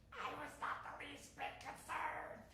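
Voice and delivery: high, soft voice of comic denial; feigned unconcern. Acted. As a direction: insist you're fine in a small high voice. Comic denial of obvious worry.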